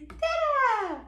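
high Dada sliding down; playful drawn-out high cheer; exclaimed refrain. Acted. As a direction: sing it out high for baby. Parent cheers book's refrain to child.

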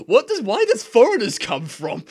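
high, loud baffled squeal; laughing disbelief; incredulous. Real, not acted.

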